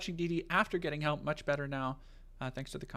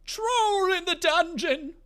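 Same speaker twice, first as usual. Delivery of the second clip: shrill, slow, panicked cry; high slow shout; 7 dB louder, much slower than usual. Pretend. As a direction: shriek it out slowly. Shouting Quirrell's line.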